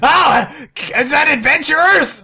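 high, loud put-on voice; excited character voice. Trapped character hearing the adventurers arrive, high-pitched. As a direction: squeal it hopefully, high up. Trapped creature answers a hail.

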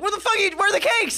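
high, frantic mock outburst; frantic Carmen. Carmen losing it at Marcus over cakes, high frantic. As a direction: freak out at him over the missing cakes. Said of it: "Carmen's like".

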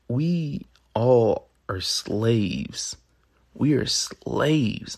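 "slaves" drawn out very slowly and weighted; stress on slaves. Shown as a demonstration. Host declaring his big point to listeners, slow heavy emphasis on "slaves". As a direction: stretch out "We all are slaves" with heavy emphasis on "slaves". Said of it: "I say that word with capital S L A V E with emphasis".

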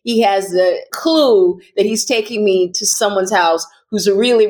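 usual speaking voice

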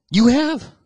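high, loud mock disbelief; acted astonished pastor. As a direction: play a pastor amazed by a confession.

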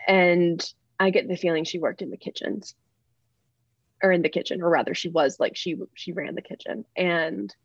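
usual speaking voice

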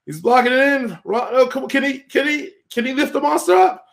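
high, rising live match call; excited play-by-play. Real, not acted. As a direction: build up the call to a shout.

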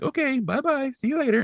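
very high imitated little voice; mock sweet, high-pitched farewell; childlike goodbye. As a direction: say bye in a tiny voice. Voiced exchange with boy in cupboard.

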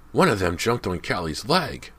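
put-on high-pitched excited kid; excited exclamation. A narrator's reading. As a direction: blurt it out, all worked up. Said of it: "James exclaimed excitedly".

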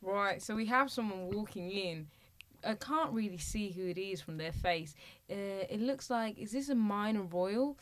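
put-on light Australian accent, building to a laughing reveal; a playful Aussie commentator at the funeral; mock Australian reporter.

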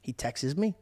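ordinary talk

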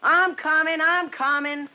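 high, loud, impatient holler; irritated yell back. Acted. Boy to his mother calling upstairs, irritated shout. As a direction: yell back that you heard already.

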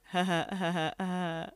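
soft, half-hearted mock chuckle; awkward laugh. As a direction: imitate an uneasy little laugh, kept quiet. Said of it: "like, a really awkward silence".

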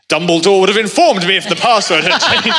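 high, pompous prefect's retort; indignant prefect. Retold or imitated. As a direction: act out an officious, put-out prefect. Acts out his blurted in-character audition reply.

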